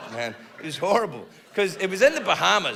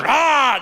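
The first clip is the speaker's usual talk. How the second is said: shark roar; 6 semitones higher, 6 dB louder than usual. Retold or imitated. Shark attacking in retold TV scene.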